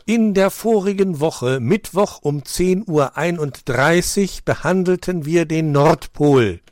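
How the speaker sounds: slow, high, careful pupil recital; slow and very clear. A narrator's reading. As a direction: spell it out slowly and precisely. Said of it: "sagte langsam und sehr deutlich".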